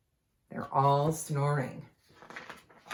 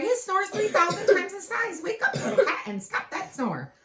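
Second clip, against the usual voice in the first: put-on much higher and louder child voice; hammed-up kid shouting; excited high cry; 4 dB louder than usual. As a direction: call out in amazement. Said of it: "Jack Huffle cried".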